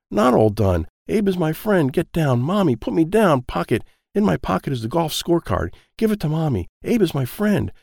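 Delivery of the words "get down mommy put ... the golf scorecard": ordinary delivery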